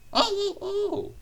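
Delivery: high-pitched "oh-oh-oh" chuckle. A narrator's reading. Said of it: "chuckled Duck".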